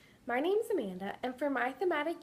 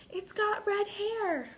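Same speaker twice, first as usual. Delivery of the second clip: put-on awed child; 9 semitones higher, much slower than usual. Girl admiring the red-haired doll, slow awed child voice. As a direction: breathe it out in a child's wonder. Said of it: "whispered Amelia".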